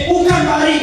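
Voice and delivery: high, insistent denial; upset customer. Retold or imitated. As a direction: push back sharply, voice way up. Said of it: "kata dia". (suara ibu yang kesal, nada tinggi)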